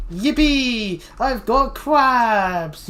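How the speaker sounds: high, loud, drawn-out cheer; mock celebration. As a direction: cheer it out slowly to the neighbors.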